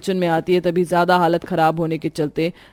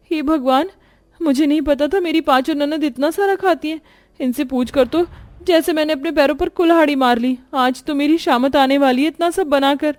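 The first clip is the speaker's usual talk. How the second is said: higher, fretting voice of an overworked bride; dismayed young bhabhi; 8 semitones higher than usual. Acted. Bhabhi to herself over the food order, dismayed and fretting.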